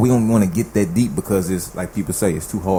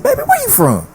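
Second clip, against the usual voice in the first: put-on high sweet granny calling him baby; grandmother's voice; 5 dB louder than usual. Grandmother asking her grandson's ex where he's from, high and sweet. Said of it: "She said".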